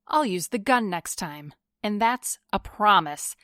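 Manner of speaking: ordinary delivery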